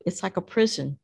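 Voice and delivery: usual speaking voice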